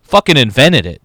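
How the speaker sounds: joking to Jeff that he invented it, loud; mock boast. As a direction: bark it out as a joke. Mock boast about wing sauce.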